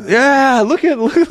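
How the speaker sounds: high, laughing gawking voice; mock excited villagers. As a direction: imitate an amazed crowd in a high pitch. Acts out villagers seeing him first time.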